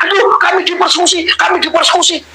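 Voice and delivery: high whiny mock cry of victimhood; mock whining. Mocking the new group crying persecution, high whine. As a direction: mimic them wailing they are persecuted. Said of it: "sekarang teriak-teriak".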